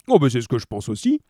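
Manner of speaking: lightly voiced cop at the table; a hint of talking while eating; thick-voiced policeman. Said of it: "de leurs grosses voix pâteuses".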